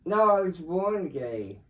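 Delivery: loud insistence. Real, not acted. Drunk uncle insisting loudly.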